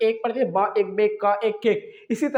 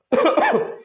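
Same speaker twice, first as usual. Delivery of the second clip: coughing fit from feeling unwell; real cough; 6 semitones higher, 3 dB louder than usual. Real, not acted. Unwell teacher interrupting the reading lesson, real coughing. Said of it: "थोड़ा सा दोस्तों मेरी तबीयत खराब है".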